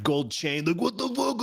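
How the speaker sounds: heavy East Coast accent impression, high-pitched mock outburst. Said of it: "East Coast accent, real heavy".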